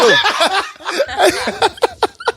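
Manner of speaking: long, high-pitched cracking up; high burst of laughter. Real, not acted. Cracking up at lookalike joke.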